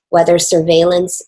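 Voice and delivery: ordinary delivery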